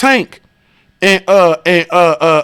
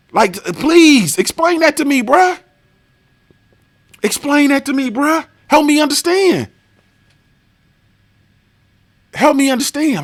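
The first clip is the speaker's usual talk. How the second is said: exasperated plea; 7 semitones higher than usual. Real, not acted. Asking commenters, sarcastically, to explain. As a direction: plead sarcastically for answers.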